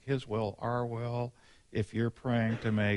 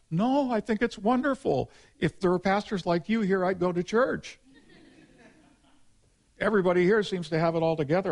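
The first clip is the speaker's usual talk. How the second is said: higher, louder, rushing with delight as the Scottish grandmother; excited grandmother; 9 semitones higher, 6 dB louder than usual. Retold or imitated. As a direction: gush over the pastor like a thrilled old lady. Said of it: "And she goes".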